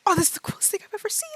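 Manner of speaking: put-on high, fast, gushing kid voice; eleven-year-old. Brother marvels at the dragon, excited child voice. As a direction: rush it out in childlike awe. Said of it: "It's like full eleven-year-old".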